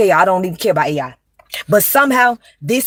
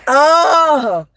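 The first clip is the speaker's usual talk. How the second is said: mock scandalized reaction; 7 semitones higher, 3 dB louder than usual. Mimicking reaction to overheard phone call.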